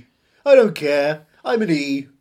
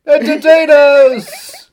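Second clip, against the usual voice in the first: loud, very slow, drawn-out announcement; showbiz proclamation; 7 semitones higher, 9 dB louder, much slower than usual. Pretend. Announcing the entertainers, loud and very slow. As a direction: proclaim it like a stage host.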